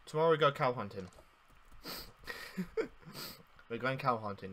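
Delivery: ordinary delivery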